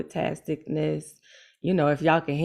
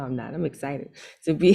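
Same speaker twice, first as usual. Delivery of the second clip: ordinary talk, nothing marked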